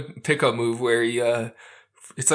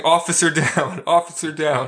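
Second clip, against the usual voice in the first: louder, higher mock cop call; mock alarm call; 4 semitones higher, 5 dB louder than usual. Podcaster retelling a cop's call over a fallen horse, mock alarm. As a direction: call it in, half laughing.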